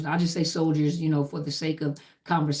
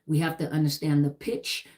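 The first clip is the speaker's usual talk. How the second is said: ordinary talk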